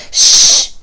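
loud, forceful hush; sharp whispered shush. A narrator's reading. Narrator silencing ranting friend, sharp shush. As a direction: shush sharply, all breath. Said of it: "I whispered sharply".

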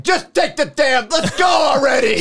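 high, loud impatient bark; impatient businessman. Pretend. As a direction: hurry them up like a late businessman. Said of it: "He's like".

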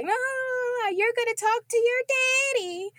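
high put-on grandma scold. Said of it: "she's just like".

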